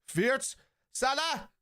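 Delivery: high, sudden outburst; excited cry. Real, not acted. Streamer crying out as Salah shoots, excited. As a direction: jump up at the chance. Reacting to chance in match.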